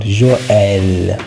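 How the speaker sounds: "Joël" very slow, leaning long on the EL; extra stress on EL. Shown as a demonstration. Teacher showing why the tréma is warranted, extra stress. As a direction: stretch the second vowel of "Joël". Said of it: "extra stress that warrants the tremor".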